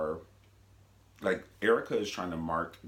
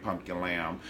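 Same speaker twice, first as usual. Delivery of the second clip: ordinary talk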